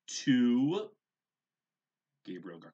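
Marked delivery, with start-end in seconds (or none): 0.1-0.9 sharply louder warning count; stern count. A narrator's reading. Mother counting to three at Gabriel, loud warning. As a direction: land the number like a final warning.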